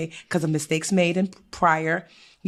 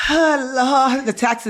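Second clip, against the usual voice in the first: mock exasperation after a sigh, higher; exasperated dread; 5 semitones higher, much slower than usual. Adviser mimics their reaction to taxes, exasperated dread. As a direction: groan it like a shared complaint.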